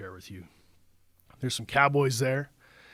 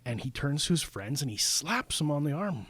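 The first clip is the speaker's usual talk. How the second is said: normal talking voice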